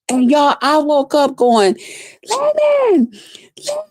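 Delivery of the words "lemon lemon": thin, high wail held back in volume; retold scream. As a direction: imitate waking up screaming a name. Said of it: "I was screaming".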